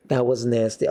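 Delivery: normal talking voice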